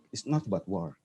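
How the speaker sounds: quiet repeated emphasis; quiet echo. Real, not acted. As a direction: say it again, almost to yourself.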